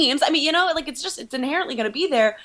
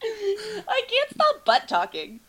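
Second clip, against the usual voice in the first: whiny mocking echo, slower; mock whining; 9 semitones higher, slower than usual. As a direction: whimper it back teasingly. Teasing echo of the slip.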